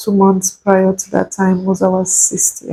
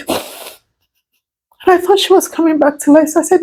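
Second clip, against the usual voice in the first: usual speaking voice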